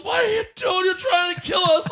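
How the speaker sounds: high, frantic shout; panicked yell. Retold or imitated. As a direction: scream it like the car just got hit. George screaming after mailbox hit.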